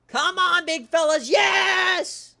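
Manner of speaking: high, full-voiced celebratory yell; cheering shout. Real, not acted. Host urging Auburn into the lead, real excited shout. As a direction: roar them on, ending in a huge yes.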